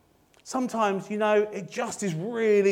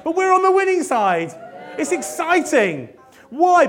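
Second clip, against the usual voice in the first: loud and high, rousing the room; excited rallying; 6 semitones higher, 6 dB louder than usual. Real, not acted. Preacher rallying the congregation, loud and excited. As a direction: lift them up, cheering.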